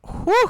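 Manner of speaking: sharp high whew of relief. Real, not acted. Relief after a close call.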